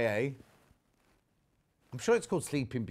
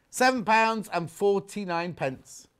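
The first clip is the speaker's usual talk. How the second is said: slow, deliberate call of the price, pitched up; price announcement; 5 semitones higher, slower than usual. Real, not acted. Seller calling out the price to viewers, slow announcement. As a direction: draw the price out word by word.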